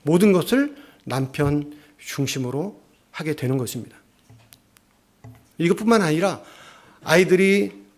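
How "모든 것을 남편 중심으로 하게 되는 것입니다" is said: ordinary talk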